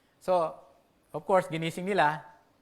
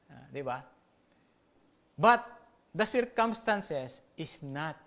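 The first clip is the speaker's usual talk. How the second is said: ordinary talk, nothing marked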